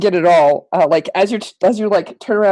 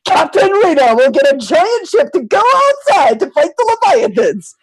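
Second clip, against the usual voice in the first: higher and louder, proclaiming like a captain; grand heroic declaration; 8 semitones higher, 3 dB louder than usual. Acted. Reina cheering up Prophet with a heroic cry. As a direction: point at the window and proclaim victory. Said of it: "She goes".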